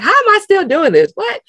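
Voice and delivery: high, loud incredulous self-quote; amused disbelief. Retold or imitated. Woman laughing at her own career path, incredulous. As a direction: squeal it in mock bewilderment. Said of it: "I always laugh. I'm like".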